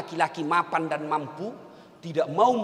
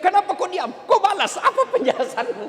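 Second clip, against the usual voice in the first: higher, louder mock scolding with a laugh; mock nagging wife; 12 semitones higher, 6 dB louder, faster than usual. Preacher imitating a nagging wife demanding answers, mock scolding. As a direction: nag like an annoyed wife, half laughing. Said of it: "juga ibu kalau ngomel".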